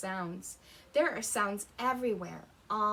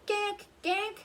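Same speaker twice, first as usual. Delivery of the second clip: high, louder duckling quacks; baby duck quack; 11 semitones higher, 5 dB louder than usual. A narrator's reading. Baby ducks at the pond, high loud quacks. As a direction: squeak it out like a tiny duck. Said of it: "They say".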